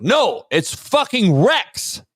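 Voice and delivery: normal talking voice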